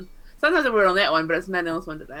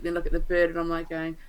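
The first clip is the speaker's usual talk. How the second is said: usual speaking voice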